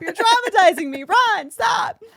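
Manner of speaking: high, loud pleading shout; panicked yelling. Retold or imitated. Dog owner yelling at Ron to stop, high and loud. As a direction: yell it in distress at the dog. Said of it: "I am full Sammy Sweetheart".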